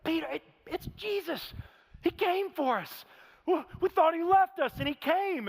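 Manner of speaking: calling out to Peter, overjoyed; excited call to Peter. Retold or imitated. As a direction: grab Peter with the news. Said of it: "he tells Peter".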